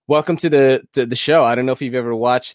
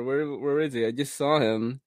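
usual speaking voice

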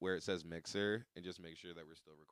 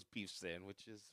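mock Italian kid; 8 dB quieter, much slower than usual. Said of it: "The kid goes".

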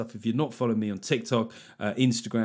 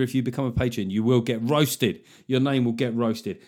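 usual speaking voice

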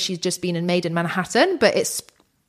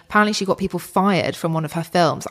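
usual speaking voice

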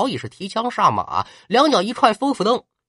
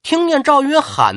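plain reading voice

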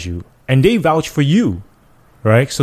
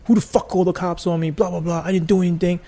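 angry resident; 8 semitones higher than usual. Retold or imitated. Retold angry resident at the door.

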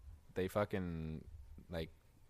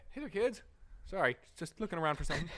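much higher, chirpy voice; kiddie show host; 9 semitones higher than usual. Pretend. Host playing a talking meth pipe for kids, high chirpy. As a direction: greet the kids like a cheery puppet.

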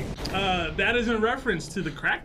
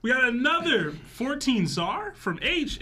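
ordinary delivery

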